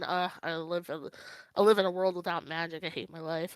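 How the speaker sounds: low and deflated, tongue in cheek; mock glum. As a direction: imitate someone mildly fed up with life. Mock quote of gloomy life.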